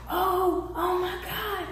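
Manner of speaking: high hushed gasp of fans spotting a celebrity; mock whisper of excited fans. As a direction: squeal it softly, trying to whisper. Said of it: "They was trying to whisper it".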